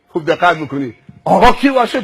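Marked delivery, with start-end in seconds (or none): 1.2-2.0 raised, cocky question; insolent challenge. Acted. Driver asking who this stranger is, insolent challenge. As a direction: challenge him loudly.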